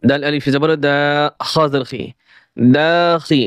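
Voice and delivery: ordinary delivery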